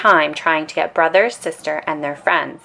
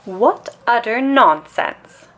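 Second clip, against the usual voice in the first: mild protest; 5 semitones higher, 3 dB louder, slower than usual. A narrator's reading. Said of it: "protested Mama".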